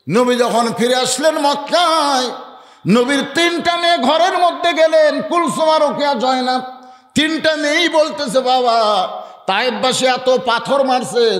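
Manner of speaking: high, chanted sermon storytelling; impassioned high sermon cadence; melodic preaching. Real, not acted. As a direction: intone the sacred story to the congregation.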